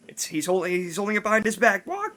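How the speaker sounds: lightly squawked parrot tattling on the magician, rising high; a hint of a squawky tattletale bird. Parrot in the story spoiling the magic trick, high squawky. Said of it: "he had a pet parrot".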